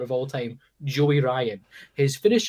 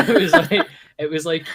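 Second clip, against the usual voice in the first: ordinary delivery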